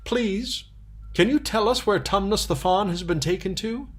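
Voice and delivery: put-on thrilled child pointing out a bird; excited young girl. Lucy asking the robin.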